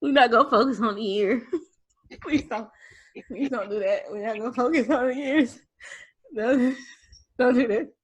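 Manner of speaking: pleading through laughter; laughing protest. Real, not acted. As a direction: try to object while cracking up. Laughing while protesting the reunion teasing.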